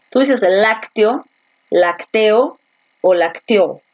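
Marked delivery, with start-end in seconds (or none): 0.4-1.3 lácteo with the stress on LAC; stress on first syllable. Shown as a demonstration. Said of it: "Donde la fuerza de voz". 1.7-2.6 lacteo stressed on TE, drawn out; stress on middle syllable. Shown as a demonstration. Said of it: "¿Cómo dices?". 3.1-3.8 lacteó stressed on the final O; stress on last syllable. Shown as a demonstration. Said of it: "¿Cómo dices?".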